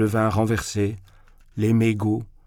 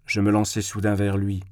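plain reading voice

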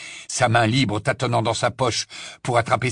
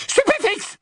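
piercing, high-pitched incantation; shrieked spell. Acted. Bellatrix fires again at the snatchers, shrieking. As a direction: scream it like a weapon. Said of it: "hurla-t-elle".

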